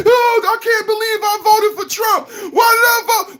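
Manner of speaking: high whiny mock lament of a regretful voter. Mocking upset Trump voters with a high, whiny lament. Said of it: "Where was every".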